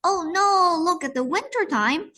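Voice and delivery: put-on high, bright oh no for kids; mock dismay. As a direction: act out a big gasp of worry.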